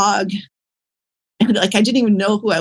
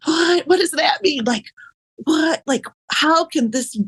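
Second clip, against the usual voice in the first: high, cracking, near-crying questions; tearful bewilderment; 7 semitones higher than usual. Retold or imitated. Woman begging the inner voice to explain, tearful and high. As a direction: plead for an answer through tears.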